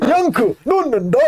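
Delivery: drawn-out brute announcement; mock Yank shout. Yank shouting while shoveling. (느리고 높게 과장한 양크의 외침)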